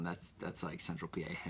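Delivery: usual speaking voice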